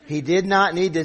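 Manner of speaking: ordinary delivery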